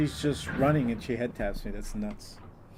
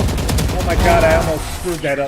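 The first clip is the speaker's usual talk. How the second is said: alarmed shout; 4 semitones higher, 11 dB louder than usual. Real, not acted. Near miss in a round.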